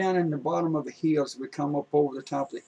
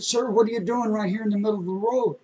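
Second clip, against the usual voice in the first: higher, louder retold question; startled, louder call to a stranger; raised calling out; 7 semitones higher, 5 dB louder than usual. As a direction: call out to the man, half alarmed. Asking stranger in the road.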